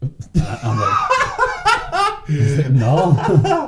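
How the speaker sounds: high, loud words through laughter; can barely get it out laughing; laughing hard. Real, not acted. Laughing at the burp story.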